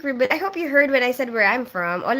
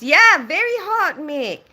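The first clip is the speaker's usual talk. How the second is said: excited; 7 semitones higher, 6 dB louder, slower than usual. Real, not acted. Exaggerated reaction to chat.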